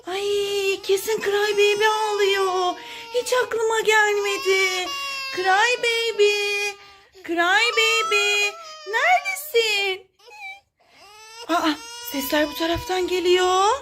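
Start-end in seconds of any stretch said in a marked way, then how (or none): 5.3-10.1 drawn-out searching call. Pretend. Searcher looking for the crying doll, calling out slowly. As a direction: stretch the name as if calling someone hidden.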